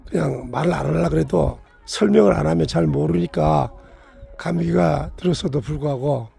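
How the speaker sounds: ordinary delivery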